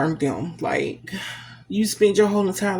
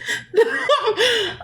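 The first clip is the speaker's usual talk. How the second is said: high teary laugh; a real laugh while crying; laughing through tears. Real, not acted. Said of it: "I'm up here bawling like a baby".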